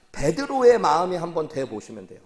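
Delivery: normal talking voice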